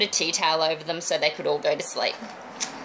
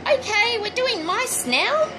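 put-on bright, higher chirpy line to the kids; cheerful announcement; 14 semitones higher than usual. Face painter telling party kids the plan, mildly chirpy and higher. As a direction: sell it like it was always the plan. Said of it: "you can literally just say".

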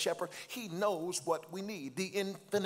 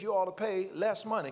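normal talking voice

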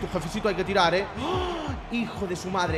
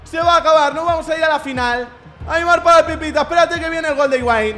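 higher, louder with eager hope; excited; 6 semitones higher, 5 dB louder than usual. Real, not acted. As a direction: build up to a win. Excited as the match nears end.

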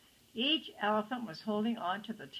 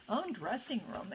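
plain reading voice

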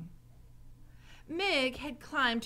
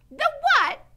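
high, loud puzzled question; Mig's shout; 14 dB louder than usual. A narrator's reading. Mig not hearing Gregory, high shout.

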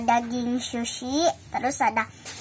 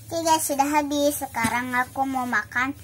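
ordinary talk

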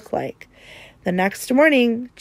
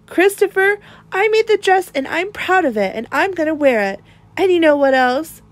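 lightly voiced boy, higher, firm and proud; defiant child; 8 semitones higher, 3 dB louder than usual. A narrator's reading. Jacob standing up to Christopher, defiant. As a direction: stand your ground proudly.

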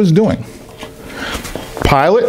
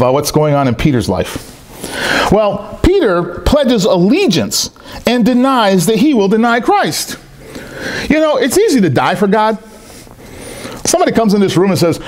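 ordinary talk, nothing marked

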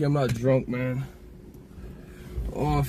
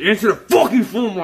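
louder, higher demand; raised, pitched-up demand; frustrated yell; 8 semitones higher, 6 dB louder than usual. Real, not acted. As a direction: yell it at the unanswered phone. Yelling at someone not answering.

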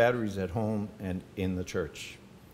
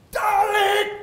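very high, loud call of Darling; 9 dB louder than usual. Retold or imitated. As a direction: shout Darling across the room, high and loud. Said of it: "he would go".

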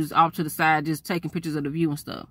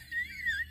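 high laugh; 13 dB quieter than usual. Real, not acted. Laughs at brother's reaction.

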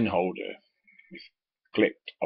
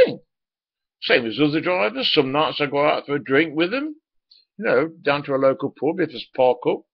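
defensive protest; 8 semitones higher, 6 dB louder than usual. Retold or imitated. Defending himself to boss.

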